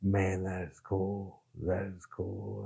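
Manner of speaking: slow, soft, drawn-out admiration; impressed. Retold or imitated. Listening to a British man read, slow and quietly impressed. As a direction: savour each word, quietly wowed. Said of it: "and I was like".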